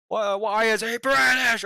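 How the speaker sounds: high, loud, slow, startled yell; surprised shout. Real, not acted. Streamer yells in surprise at a singer's British accent. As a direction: blurt it out in amazement.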